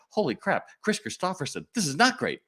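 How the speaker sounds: high, alarmed realization; the thrill flips to horror, not stressed; dawning panic. Retold or imitated. Clerk finds the boots are the wrong size, high alarmed panic.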